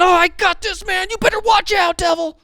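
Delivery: high, loud, cocky challenge; hyped-up again. Retold or imitated. Pastor mimics a believer hyping himself against the devil. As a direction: taunt the devil like a wrestler. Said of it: "hype yourself up again".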